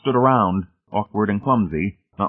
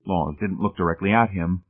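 ordinary delivery, nothing marked

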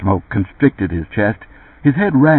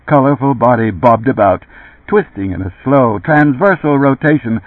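plain reading voice

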